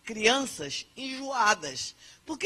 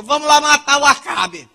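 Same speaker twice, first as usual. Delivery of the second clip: loud scheming Jezabel; 7 semitones higher, 19 dB louder than usual. Acted. Jezabel voiced plotting loudly.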